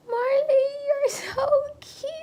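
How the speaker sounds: high, slow, crying coo; tearful coo. Retold or imitated. Mother meeting her newborn daughter, high tearful coo. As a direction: gush over the baby through tears. First moment holding baby.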